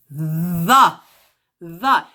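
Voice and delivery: the with a voiced, humming th; buzzing the. Shown as a demonstration. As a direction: let the th in the buzz in the throat. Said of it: "Don't you hear your voice vibrating?".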